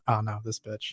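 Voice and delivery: low, soft disgusted mutter; disdainful Pokemon. Retold or imitated. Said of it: "he's like".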